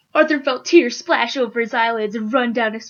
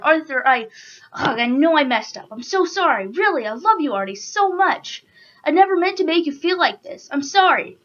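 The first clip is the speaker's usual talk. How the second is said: higher-pitched, earnest apology; pleading apology; 6 semitones higher than usual. Acted. Alfred telling Arthur he messed up, pleading apology. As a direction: plead for forgiveness, voice lifting higher.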